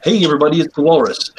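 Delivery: loud, bright stream greeting; streamer intro. Acted. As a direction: hype up the viewers.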